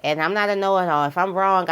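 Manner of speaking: ordinary talk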